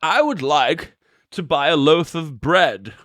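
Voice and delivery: slow, lightly put-on voice of a polite shopper; movie quote voice. Streamer quoting The Mummy to chat in a slow mock-formal voice. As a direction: ask for the bread slowly like a film character. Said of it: "The Mummy?".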